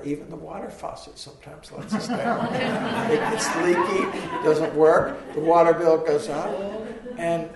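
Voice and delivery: laughing loudly through the words. Real, not acted. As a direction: laugh out the joke, loud. Joking about leaky faucet.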